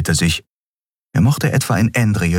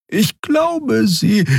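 much higher voice breaking off; Xea cut off; much slower than usual. A narrator's reading. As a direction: stop mid-word, high. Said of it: "Xea verstummte".

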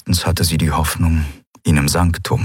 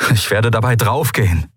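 despairing; 5 semitones higher than usual. A narrator's reading. Kai despairs before the Eidolon.

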